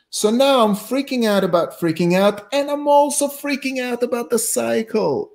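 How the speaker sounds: high-pitched, hammed-up scientist reasoning; inner scientist. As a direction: play a scientist spiralling into mock panic. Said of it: "the scientist of me, in me goes".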